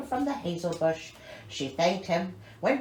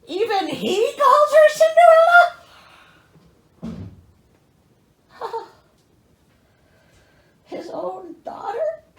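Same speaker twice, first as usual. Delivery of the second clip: much higher and louder, aghast; shocked disbelief; 13 semitones higher, 10 dB louder than usual. Real, not acted. Reader outraged at the father, high and shocked. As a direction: squeal it in outrage.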